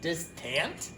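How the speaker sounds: Distant? with a clear tant ending, puzzled rise; questioning Distant? with the A said fully; full A vowel. Shown as a demonstration. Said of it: "That doesn't sound right".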